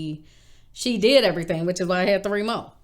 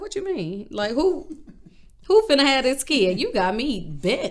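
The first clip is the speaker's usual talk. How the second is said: incredulous retort; 7 semitones higher, 4 dB louder than usual. Retold or imitated. Rejecting mom's reassurance.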